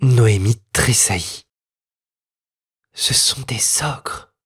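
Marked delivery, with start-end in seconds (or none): 2.9-4.3 put-on slow, breathless whisper of a scared girl; frightened whisper. A narrator's reading. Little girl whispering to herself, hidden in fear. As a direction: hush it as a private terrified thought. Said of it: "pensa-t-elle".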